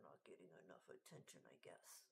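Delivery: fast low whispered aside. Real, not acted. Aside about the needy cat.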